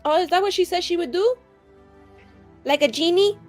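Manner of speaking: ordinary talk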